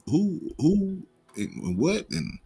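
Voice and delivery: ordinary talk, nothing marked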